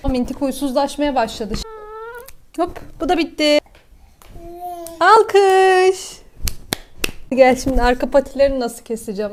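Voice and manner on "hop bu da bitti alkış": cheering. Real, not acted. Cheering after a clipped paw.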